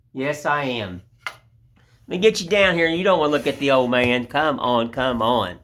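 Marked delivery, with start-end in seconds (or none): none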